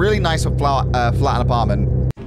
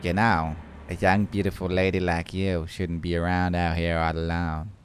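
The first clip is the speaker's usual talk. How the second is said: low, soft, menacing monotone; creepy stranger; 7 semitones lower, 6 dB quieter than usual. Acted. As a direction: voice a creepy man, quiet and low. Reads creepy character's lines.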